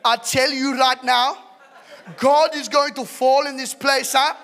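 loud, slow, high-pitched South African preaching; South African evangelist. Shown as a demonstration. Showing how an evangelist should sound, South African revival shout. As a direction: thunder it out, building to a shout, South African accent. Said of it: "Ben Fitzgerald or South African".

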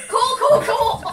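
calling out through laughter; loud laughing call. Real, not acted. Laughing at friend.

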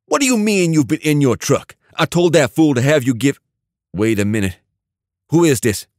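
a suspicious thug demanding answers; angry gang boss. A narrator's reading. Dante snapping at the stranger on the phone, angry.

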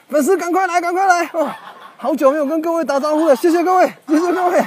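high-pitched crowd greeting; high, gushing thanks to the audience; excited calling out. Real, not acted.

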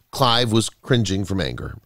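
plain reading voice

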